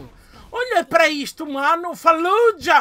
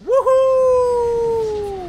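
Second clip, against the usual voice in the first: high, louder whoop of joy; victory whoop; 9 semitones higher, 6 dB louder than usual. Real, not acted. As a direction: celebrate the win out loud. Victoire en jeu.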